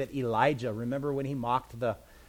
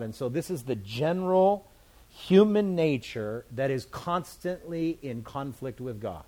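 ordinary delivery

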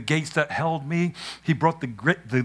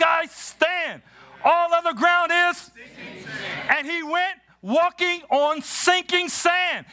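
high, driving preacher's cadence with shouted peaks; full-force preaching; 11 semitones higher than usual. Acted. Pastor preaches to the congregation at Grace Covenant, high and fervent. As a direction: preach it with rising fire.

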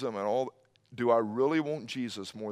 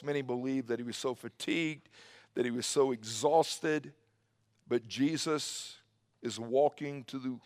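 normal talking voice

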